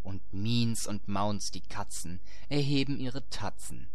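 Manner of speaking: usual speaking voice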